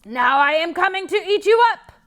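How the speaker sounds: troll menacing the goat; troll threat. Acted. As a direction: threaten to gobble him. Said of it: "cried the troll".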